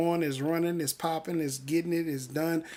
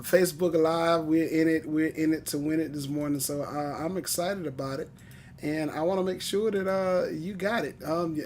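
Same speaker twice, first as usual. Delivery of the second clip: ordinary talk, nothing marked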